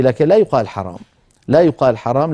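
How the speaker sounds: normal talking voice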